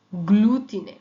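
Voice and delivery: গ্লুতিনে said loudly with a hard g and clear l; Italian word demonstrated. Shown as a demonstration. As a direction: punch গ্লুতিনে with a crisp gl. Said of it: "যেমন গ্লাসা".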